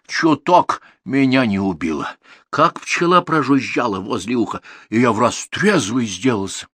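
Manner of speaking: plain reading voice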